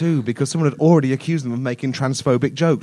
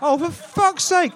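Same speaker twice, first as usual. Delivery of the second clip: exasperated outburst; 9 semitones higher than usual. Acted. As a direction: throw up your hands at the cleric. Gingerbread man exasperated at cleric.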